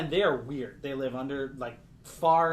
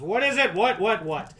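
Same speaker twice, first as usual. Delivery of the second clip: louder, higher irritated questions; impatient bartender; 6 semitones higher, 8 dB louder than usual. Acted. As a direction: snap back at the nuisance.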